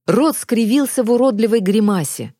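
ordinary delivery, nothing marked

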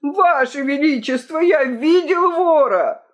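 high, urgent cry; loud wail. A narrator's reading. Key-keeper on his knees to the king, wailing urgently. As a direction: cry it out high, as a breathless announcement. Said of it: "завопил громким голосом".

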